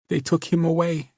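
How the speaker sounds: put-on high, whimpering young woman. A narrator's reading. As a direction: whimper it, near tears. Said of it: "she whimpered".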